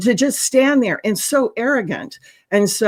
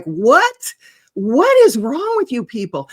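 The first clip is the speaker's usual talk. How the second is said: mock bewildered, pitched up and louder on "what"; incredulous outburst; 4 semitones higher, 4 dB louder, slower than usual. Commentator reacting to out-of-touch officials, incredulous. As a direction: demand it in exaggerated disbelief.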